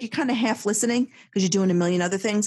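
ordinary talk, nothing marked

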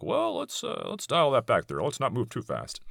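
a hint of a nervous liberal, pitch jumping up at the start; mock backpedaling. Liberals balking at anti-gentrification laws, lightly mocked hedge. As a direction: lightly voice a hedging, uneasy liberal.

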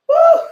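high, loud laughing whoop; joyful woo. Real, not acted. Preacher whooping with joy while blessing viewers. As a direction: let out a gleeful woo.

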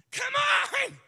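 high, loud joyful exclamation; excited cry. Acted. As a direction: burst out with delight. Shepherd exclaiming.

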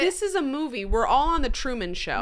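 amazed exclamation. Real, not acted. Co-host marvelling at friend's unbelievable story, amazed exclamation. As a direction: burst out at the absurdity.

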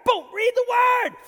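roaring read the word brother; booming high shout of an old church lady; shouted command. Retold or imitated. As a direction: yell it while swinging a Bible. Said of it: "She starts hitting him".

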